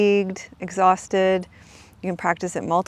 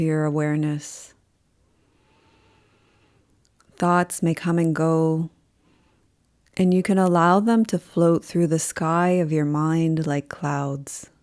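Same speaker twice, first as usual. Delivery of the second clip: slow, calm yoga nidra voice with long pauses; guided meditation. Acted. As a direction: guide them unhurriedly into rest, leaving space after each phrase.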